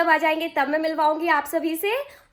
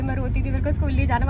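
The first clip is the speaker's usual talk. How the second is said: usual speaking voice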